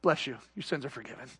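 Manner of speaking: quick, soft and low; a lightly voiced calm priest; priest's voice. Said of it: "The father said".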